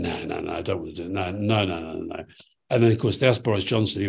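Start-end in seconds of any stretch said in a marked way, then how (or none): 0.0-2.2 soft, hurried string of no's; mock refusal. Dad to the promoter, retold, quietly refusing the meet and greet. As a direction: wave it off quietly, half-embarrassed.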